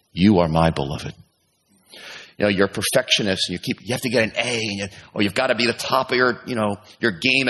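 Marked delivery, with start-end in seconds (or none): 0.1-1.1 low, gentle reassurance; tender. Acted. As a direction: comfort the aching heart gently. God comforting lonely listener.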